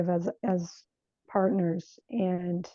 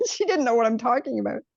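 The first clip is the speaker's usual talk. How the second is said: ordinary delivery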